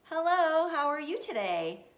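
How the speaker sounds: high, loud, too-bright greeting; chirpy kid hiding trouble; nervous fake cheer. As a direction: act innocent to angry parents.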